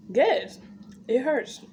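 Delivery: normal talking voice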